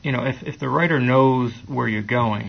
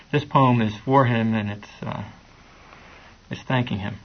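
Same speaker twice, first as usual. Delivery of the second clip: ordinary talk, nothing marked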